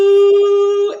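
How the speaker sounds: one long, high, loud droning tone; imitated ear buzzing. Speaker showing the adrenaline rush before performing, loud high buzzing. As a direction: mimic ringing ears with a held high note. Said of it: "your ears start buzzing".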